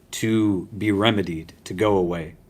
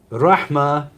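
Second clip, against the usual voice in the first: Rahma lifted higher, set apart; stressed Rahma; 5 semitones higher than usual. Shown as a demonstration. Teacher turning back to the key Arabic word, stressed Rahma. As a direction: announce the word Rahma clearly. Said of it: "rahma has its own unique element".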